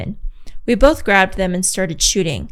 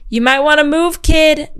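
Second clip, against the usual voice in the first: higher, a bit louder warning call; yell; 7 semitones higher, 5 dB louder than usual. A narrator's reading. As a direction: call the warning out across the playground. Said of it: "B C yells".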